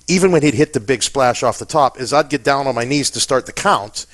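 ordinary delivery

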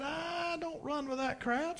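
put-on high, slow, soft old preacher; a hint of a frail old man, drawn out; quoting the old preacher, high and slow; old preacher's voice.

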